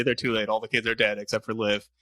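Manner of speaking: usual speaking voice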